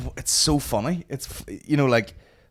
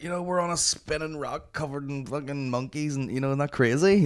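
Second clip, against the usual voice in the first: mock stoner musing in a higher voice; stoned podcaster; 5 semitones higher than usual. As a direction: imitate someone high marvelling at the world. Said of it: "he smokes a load of weed and he's all like".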